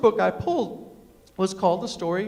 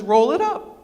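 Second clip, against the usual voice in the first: brisk order; 4 semitones higher, 8 dB louder than usual. Retold or imitated. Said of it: "she says".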